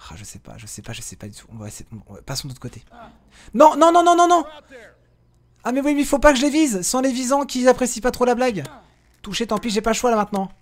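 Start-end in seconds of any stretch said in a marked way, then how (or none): 3.5-4.4 high, loud no no no; high alarmed cry of no; panicked shout. Real, not acted. Companions attack against his will. 5.6-10.5 flustered. Real, not acted. Fight breaks out, reacting.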